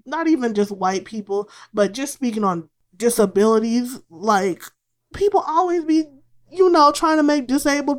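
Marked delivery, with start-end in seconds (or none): none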